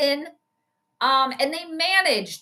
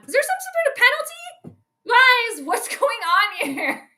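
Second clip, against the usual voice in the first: baffled questions; 7 semitones higher than usual. Real, not acted. Confused about penalty.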